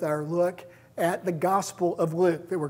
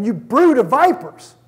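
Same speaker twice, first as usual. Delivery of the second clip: loud, higher rebuke to the crowd; rebuking shout; 5 semitones higher, 7 dB louder than usual. Acted. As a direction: hurl it at the crowd like a prophet. Preacher voicing John rebuking crowds.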